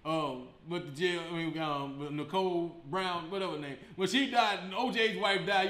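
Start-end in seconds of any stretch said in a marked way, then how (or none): none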